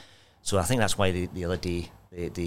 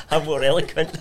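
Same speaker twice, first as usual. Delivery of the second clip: laughing; 10 semitones higher, 10 dB louder than usual. Real, not acted. Laughing at compliment.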